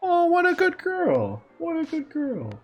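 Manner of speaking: usual speaking voice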